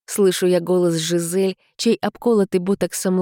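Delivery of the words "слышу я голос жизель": ordinary delivery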